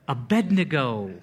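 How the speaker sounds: "Abednego" as a-BED-ne-go; correct pronunciation. Shown as a demonstration. Preacher insisting on his pet peeve, "Abednego" as a-BED-ne-go. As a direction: put the D before the N in "Abednego": uh-BED-nuh-go. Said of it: "how to pronounce this name".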